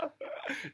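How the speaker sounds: airy, high imitated laugh. Imitating his mate dying laughing at the prank, high laughing. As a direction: mimic a wheezy high laughing fit. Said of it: "you know, high laughing".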